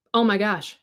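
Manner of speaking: ordinary talk, nothing marked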